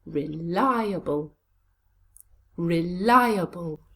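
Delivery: Reliable said slowly as one joined word, twice; slow careful model of Reliable; slow blended word. Shown as a demonstration. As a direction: stretch Reliable with the weight on li. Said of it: "Now let's put those together".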